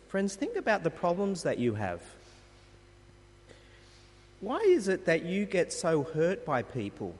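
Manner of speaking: normal talking voice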